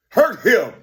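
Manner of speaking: short, forceful mock command; imitating their talk. Imitating how they talk about hurting him, forceful. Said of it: "That's how they talk".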